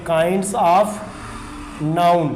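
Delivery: "kinds of", long pause, then "noun"; slow dictation. Shown as a demonstration. Teacher dictates heading while writing it.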